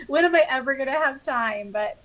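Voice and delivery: ordinary talk, nothing marked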